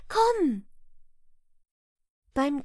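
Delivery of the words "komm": komm called much higher and louder; mother's urgent high call to her son; calling loudly. A narrator's reading. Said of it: "rief mit deutlicher Stimme".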